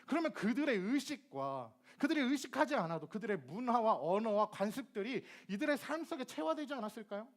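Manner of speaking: ordinary delivery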